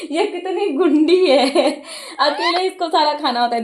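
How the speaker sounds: amused, drawn-out, laughing through the words; slow laughing scold of a greedy parrot. Real, not acted. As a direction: chuckle at the naughty bird.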